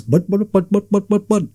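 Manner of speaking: babbling in an exaggerated Indian accent; mock Indian accent. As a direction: imitate Indian shopkeeper chatter. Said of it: "They do a great Indian accent".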